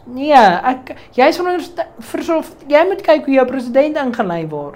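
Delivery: mock-outraged phone reply; indignant retort. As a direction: retell it as an exasperated scolding. Retelling an indignant phone call reply.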